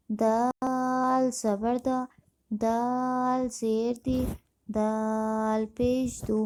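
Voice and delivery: دال held long on its aa, then the rest short; slow spelling drill, long-drawn دال; elongated madd. Shown as a demonstration. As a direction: stretch the vowel in دال for five counts. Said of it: "مد ہوگا اسے ہم پانچ الف کھینچیں گے".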